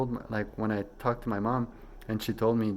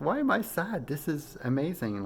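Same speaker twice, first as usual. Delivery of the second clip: much higher, elated self-talk; sudden joy; 6 semitones higher than usual. Retold or imitated. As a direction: flip from sadness to delight. Joy at going to source.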